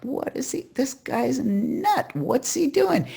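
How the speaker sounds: put-on high-pitched, incredulous little-kid voice; acted child spying on someone odd; baffled kid. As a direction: gawk in high-pitched disbelief. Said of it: "What she sees is like".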